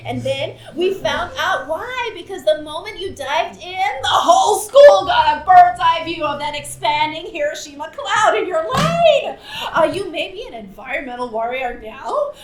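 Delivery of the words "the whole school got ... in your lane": laughing punchline. Acted. Punchline of embarrassing story.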